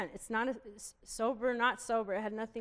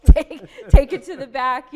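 slow laugh-through line, much louder; 4 semitones higher, 19 dB louder than usual. Retold or imitated. Retelling handing back the loofah at Target, laughing loudly. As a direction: laugh out the words while retelling it.

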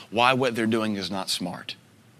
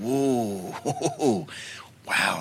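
laughing in wonder; amused whoa. Retold or imitated. Panic attack in the pit, he laughs in wonder.

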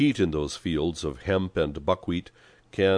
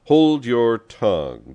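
loud, slow, stern command; raised-voice order, higher and drawn out; sharp interruption; 5 semitones higher, 8 dB louder, slower than usual. A narrator's reading. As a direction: cut him off forcefully. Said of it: "Don Camillo interrupted him".

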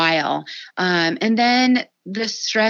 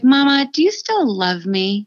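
put-on high, slow little-kid plea; four-year-old son; 5 semitones higher, 3 dB louder than usual. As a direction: lightly imitate a small child asking mom for reassurance. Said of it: "my younger one, who at the time was, like, four".

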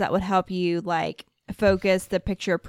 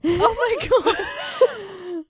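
laughing; 12 semitones higher, much slower than usual. Real, not acted. Cracking up at coronavirus joke.

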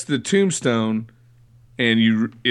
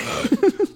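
high, cartoonish evil cackle; cartoon monster cackle. Shown as a demonstration. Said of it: "to do this sound".

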